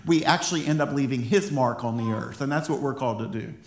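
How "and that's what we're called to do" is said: usual speaking voice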